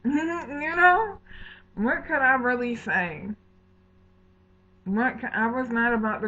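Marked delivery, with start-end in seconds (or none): 0.0-3.3 louder, higher exasperation; pissed-off rhetorical question; exasperated. Real, not acted. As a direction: vent the frustration, pitched up. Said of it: "I'm getting pissed".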